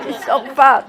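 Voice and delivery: laughing through the word; a real laugh while answering. Real, not acted. Laughing at her childhood answer. (צוחקת תוך כדי דיבור)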